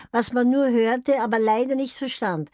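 ordinary delivery

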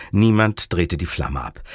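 usual speaking voice

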